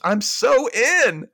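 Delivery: loud, high, drawn-out triumph; hammed-up excited cry; exclaiming. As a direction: exclaim it slowly and exultantly. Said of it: "[name] exclaims".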